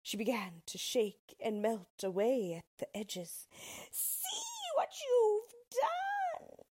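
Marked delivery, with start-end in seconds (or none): 3.9-6.7 very high, slow, wailing cry; wailing witch. Acted. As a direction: wail in despair. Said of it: "she cried".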